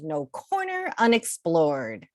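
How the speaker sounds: plain reading voice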